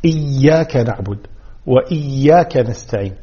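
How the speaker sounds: إياك نعبد وإياك نستعين with held double y; doubled ya. Shown as a demonstration. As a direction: press on the y of each إياك. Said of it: "بتشديد الياء". (إياك نعبد بتشديد الياء)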